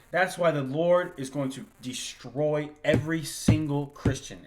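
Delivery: normal talking voice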